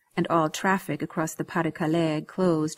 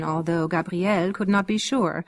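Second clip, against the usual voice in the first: plain reading voice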